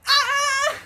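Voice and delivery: high, loud panicked scream; mock internal scream. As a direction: shriek "ah" in mock horror. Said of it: "I'm internally screaming".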